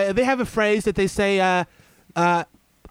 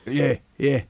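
mock London roadman; 6 semitones lower than usual.

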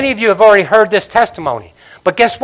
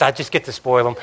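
soft aside; 9 semitones lower, 10 dB quieter than usual. Real, not acted.

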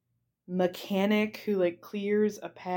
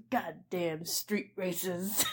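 grumbling driver; 7 dB quieter, much slower than usual. Retold or imitated. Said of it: "the Pizza Hut driver goes".

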